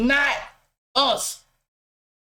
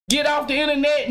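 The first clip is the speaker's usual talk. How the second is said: ordinary delivery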